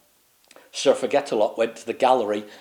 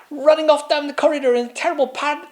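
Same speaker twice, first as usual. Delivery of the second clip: usual speaking voice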